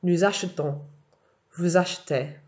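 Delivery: Nous achetons and vous achetez with the middle e nearly dropped; swallowed e. Shown as a demonstration. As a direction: let the e in achetons and achetez vanish. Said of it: "virtually disappears when French people say".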